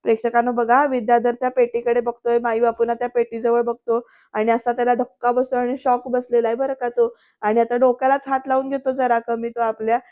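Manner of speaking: ordinary delivery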